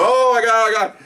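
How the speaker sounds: high-pitched mock exclamation of hilarity; mock hysterics. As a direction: cry it out high, like a room in uproar. Imitates the whole room laughing.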